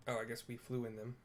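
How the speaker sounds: usual speaking voice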